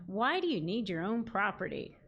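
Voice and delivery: normal talking voice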